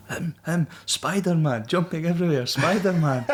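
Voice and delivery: high, laughing accusation; accusing locals. Retold or imitated. Locals pointing at him for the police, high and laughing.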